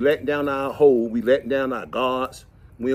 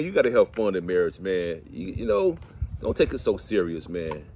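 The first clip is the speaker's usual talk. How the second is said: normal talking voice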